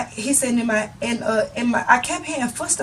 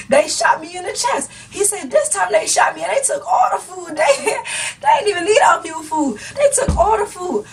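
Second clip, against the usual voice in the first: laughing retelling; 7 semitones higher than usual. Retold or imitated. Driver retells, laughing high.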